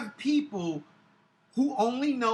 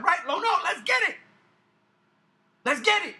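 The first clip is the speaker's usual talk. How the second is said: loud, high, rapid-fire crowd rousing; fired-up hype host; 8 semitones higher, 4 dB louder than usual. Acted. Fan showing how he'd command a panel audience, shouted hype. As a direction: grab the audience with big shouted energy.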